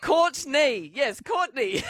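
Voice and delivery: delighted, laughing confirmation. Real, not acted. Confirms caller's answer, laughing.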